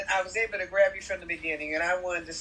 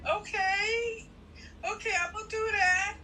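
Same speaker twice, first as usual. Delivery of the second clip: tearful resolve; 14 semitones higher than usual. Retold or imitated. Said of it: "And I'm like".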